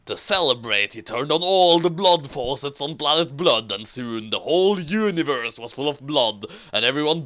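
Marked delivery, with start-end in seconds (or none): none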